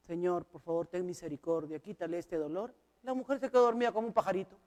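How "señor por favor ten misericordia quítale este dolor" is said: soft, low plea for mercy over the sick bed; hushed prayer. Retold or imitated. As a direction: pray quietly, close and earnest. Praying over the sick woman. (oración suave y baja)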